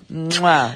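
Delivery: exaggerated smacking mwah; pretend kiss. Co-host acts out kissing a lonely stranger's cheek, pretend kiss.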